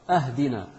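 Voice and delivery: "اهدنا" alone as ahdina, a instead of i; meaning-changing vowel error. Shown as a demonstration. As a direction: open "اهدنا" with a fatha. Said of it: "بمعنى الهدية من الإهداء".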